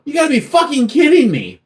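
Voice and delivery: "You gotta be fucking kidding me" in a high, fast, disbelieving voice; exasperated high mock yell. Son opens the bare fridge, a high exasperated mock yell. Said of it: "and you go".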